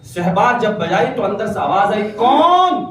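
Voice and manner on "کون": high, loud sharp challenge from behind the door. Acted. Mother calling through the closed door, sharp and loud. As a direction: snap the question out high. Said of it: "بڑے تیکھے لہجے میں آواز آئی".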